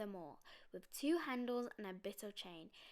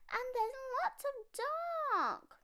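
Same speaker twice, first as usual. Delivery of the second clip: high, slow frightened cub; little bear voice; 8 dB louder, slower than usual. Acted. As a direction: drag it out like a scared little cub.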